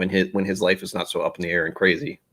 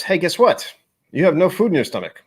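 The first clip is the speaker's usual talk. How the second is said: higher, louder inner voice breaking the news; mock-chirpy scolding of oneself; negative self-talk; 9 semitones higher, 4 dB louder than usual. Streamer's inner voice pointing out an empty stomach, negative self-talk. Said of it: "it's the negative self-talk".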